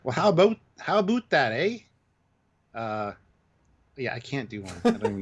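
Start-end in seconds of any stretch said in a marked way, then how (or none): 0.0-0.6 Canadian Minnesotan accent. Shown as a demonstration. Asked for his best Canadian accent, tentative Canadian "about" as "a-boat". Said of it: "My best Canadian accent is a Minnesotan accent". 0.8-1.8 Canadian Minnesotan accent. Shown as a demonstration. Second try at his best Canadian accent, "about" as "a-boot". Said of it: "My best Canadian accent is a Minnesotan accent".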